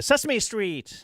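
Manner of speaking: deadpan showman punchline; imitating the chef. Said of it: "He's like".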